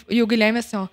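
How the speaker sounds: usual speaking voice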